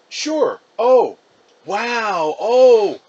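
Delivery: mother reacting with delight to the caller; surprised on the phone. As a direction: mom impressed by the news.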